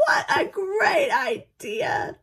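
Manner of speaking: thundering giant. Acted. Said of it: "thundered the giant".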